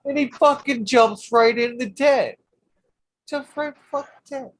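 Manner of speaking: he can't believe the toad went in there; incredulous outburst. Real, not acted. Telling a friend how the toad jumped into the tent, high and incredulous.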